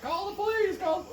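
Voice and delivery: mock panicked caller. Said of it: "the group that was like".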